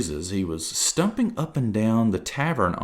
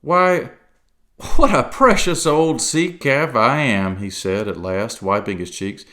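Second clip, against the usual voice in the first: loud, higher merry sea cook; jovial pirate; 5 semitones higher, 6 dB louder than usual. Acted. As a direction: chuckle along like an old pirate. Silver role.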